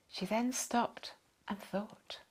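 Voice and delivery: usual speaking voice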